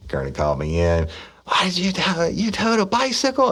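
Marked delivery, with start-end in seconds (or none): 1.5-3.5 high, put-on voice of an incredulous boss; mock boss demanding why; imitating the boss. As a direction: play the baffled boss, pitched way up. Boss Kearney voiced in story.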